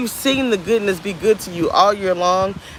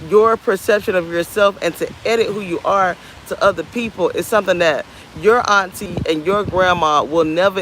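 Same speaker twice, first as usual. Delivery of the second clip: normal talking voice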